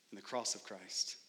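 usual speaking voice

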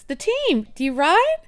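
high, bright-eyed shop man voice; chirpy shop guy. Retold or imitated. Bike shop guy asking the girl if she rides, high and eager.

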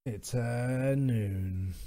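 very slow, soft, low-key showdown line; game catchphrase. Acted. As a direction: draw every word right out, softly. Repeats game catchphrase slower, quieter.

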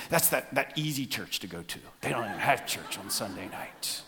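low, very soft aside; mock hushed gossip. Churchgoers talking about the easy church, mock hushed. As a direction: drop it down as if passing on gossip.

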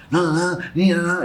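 mild mock German argument; imitated quarrelsome intonation. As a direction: rattle off objections as if bickering. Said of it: "a entonação e tudo".

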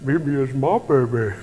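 mocking little-girl impression of grandpa. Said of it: "she lowers her voice like this".

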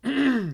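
sharp throat clear. Real, not acted. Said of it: "sorry, I'm losing my voice".

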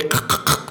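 throaty crackle for thunder; rough mouth-made thunderclaps; imitated thunder cracks. Imitating thunder after the spray.

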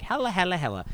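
lightly voiced high cartoon kid; a hint of cartoon-character voice; Cartman. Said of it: "Carmen's like".

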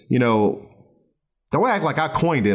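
normal talking voice

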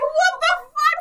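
high-pitched, outraged shriek; screaming at her. Retold or imitated. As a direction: yell it loud and high in disbelief. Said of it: "I, like, screamed at her".

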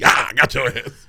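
mock taunt. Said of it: "He be".

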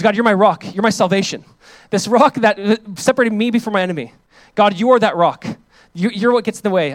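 loud, fervent prayer voiced for David; David's prayer. Preacher crying out David's prayer to God, loud and fervent.

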